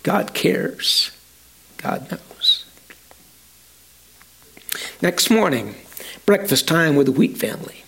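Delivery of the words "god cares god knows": soft unvoiced whisper, barely there. A narrator's reading. Wife comforting her husband in the dark, whispering. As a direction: breathe it to him gently in the dark. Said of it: "As she whispers".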